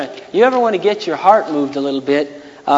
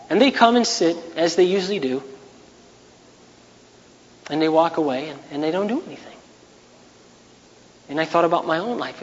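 ordinary talk

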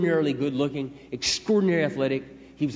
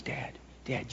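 breathless tearful call; 12 dB quieter than usual. Retold or imitated. Daughter crying on phone.

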